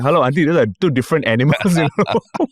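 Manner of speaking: fast, high and incredulous; quick disbelieving comeback; exasperated retort. Retold or imitated. Retorting to the auntie.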